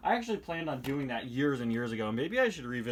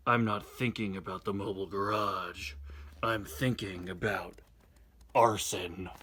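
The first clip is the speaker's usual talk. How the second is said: low, slow menacing delivery; deadpan villain threat; darkly lowered comic voice; sinister joke voice; 5 semitones lower, slower than usual. Acted.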